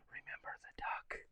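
hushed blackmail whisper; menacing whisper. Acted. Jenny leaning over to Johnny, whispered threat. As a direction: whisper it slyly into an ear. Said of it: "that whispers".

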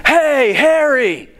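high, slow call across the field; yelling a name. Retold or imitated. Fan calling the player from the stands, drawn-out yell. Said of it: "and he hears".

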